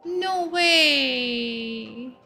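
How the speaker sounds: normal talking voice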